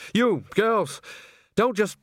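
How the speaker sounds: high, slow rasping command; rasped order. A narrator's reading. Bucket ordering nervous chorus girls about, high rasped command. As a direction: rasp it out high at the idlers. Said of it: "he rasped".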